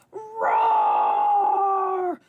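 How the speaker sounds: long, high shouted roar; loud dinosaur roar. Acted. Daryl roaring in the library, long loud dinosaur roar. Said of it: "It's a little too loud for the library".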